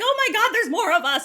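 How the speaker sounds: high, loud amazed outburst; excited freak-out. Retold or imitated. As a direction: re-enact freaking out at the news. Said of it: "freaking the fuck out".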